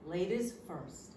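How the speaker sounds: a hint of a courteous man, slow; lightly voiced gentleman, unhurried; gentlemanly man. Stranger politely yielding the sandwich, lightly voiced and slow.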